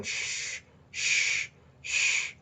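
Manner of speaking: a whispered librarian's shh; soft, breathy shushing, three times. Retold or imitated. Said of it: "She's, like, going".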